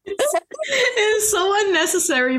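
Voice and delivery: high, slow words squeezed through laughter; helpless giggling as she talks; laugh-crying. Real, not acted. Laughing at the scene.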